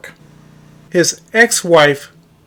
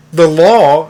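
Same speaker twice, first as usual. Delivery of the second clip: "law" hit louder and held; stress on LAW; 4 dB louder than usual. Retold or imitated. Challenging the young lady's claim, stress on "law". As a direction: lean hard on the word "law". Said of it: "I'm stressing the word law".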